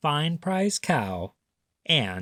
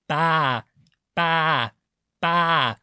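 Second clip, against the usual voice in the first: loud, high bleating baas; sheep bleat; 3 semitones higher, 4 dB louder, much slower than usual. Acted. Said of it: "said the fat red hen".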